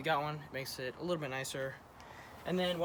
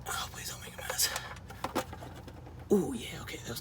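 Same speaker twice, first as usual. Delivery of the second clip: whispering. Real, not acted. Draining oil, tense moment.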